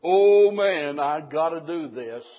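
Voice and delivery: mock-moping groan, loud at first then dropping; moping attitude. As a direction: act out a reluctant complaint. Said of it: "not moping around with this attitude".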